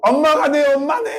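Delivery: high, overjoyed acted outcry; imitated shout. Daughter discovers mom is her real mother, shouting. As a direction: cry it out in amazed discovery. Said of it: "소리 지르면서 되게".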